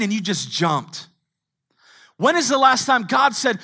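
loud, high, driving preaching; loud sermon climax; full-force preaching. Acted. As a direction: preach it hard to the room.

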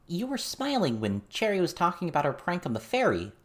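put-on a girl probing why he smiled; curious teen girl. Verona asking John why he was smiling, curious girl voice.

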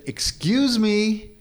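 slow, high drawn-out call. Retold or imitated. Neighbor keeps raking, so he calls again, drawn out. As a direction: stretch it out to get his attention. Calling louder to unresponsive neighbor.

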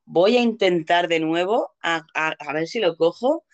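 ordinary talk, nothing marked

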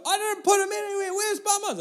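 put-on high child voice, protesting and demanding; insistent, nearly tearful kid; protesting little girl. Daughter answering Dad's question, high protesting child voice.

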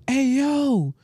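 high, drawn-out mock hail; calling out. Retelling customers hailing him at work, high call. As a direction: imitate a girl calling across the store.

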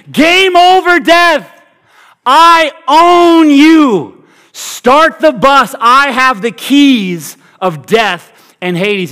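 high, loud gloating over death; Jesus trash-talking death, high and loud and gloating; triumphant trash talk. Pretend. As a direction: taunt death like a winning team. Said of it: "That's triumphant trash talk".

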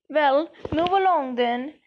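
understated brisk order; mildly sharp. A narrator's reading. Professor McGonagall herding the crowd into the hall, mildly sharp. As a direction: move the crowd on with a touch of sharpness. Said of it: "said Professor McGonagall sharply".

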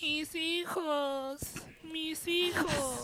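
high, very slow ghostly wail; weeping ghost woman. Retold or imitated. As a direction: drag it out like a mourning spirit. Retelling the ghost outside windows.

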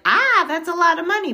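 loud, surprised reaction to the price; shocked at the price. Retold or imitated. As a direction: blurt it out, startled by the cost. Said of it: "And I was like".

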